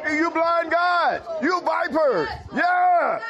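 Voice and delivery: preacher shouting down the hypocrites; fiery preaching shout. Shown as a demonstration. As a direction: hurl the insults from the pulpit. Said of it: "that's how I say it".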